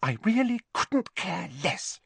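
haughty schoolgirl feigning indifference; prim Hermione. A narrator's reading.

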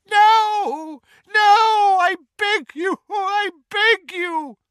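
very high, loud screaming plea; agonized begging. Acted. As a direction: scream it in agony. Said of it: "a horrible, drawn-out scream".

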